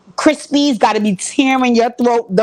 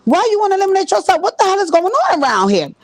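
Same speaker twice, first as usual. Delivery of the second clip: Deb shocked; 9 semitones higher than usual. Retold or imitated. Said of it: "So Deb was like".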